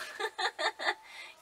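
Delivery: sudden high laugh; surprised laugh. Real, not acted. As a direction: burst out laughing at the dog. Dog interrupts the video.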